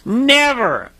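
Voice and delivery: "Never" called high, with a long fall; underlined never. Real, not acted. Preacher telling folks to underline it, emphatic high "Never". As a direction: hit "Never" high and let it ring alone. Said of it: "Underline that, folks".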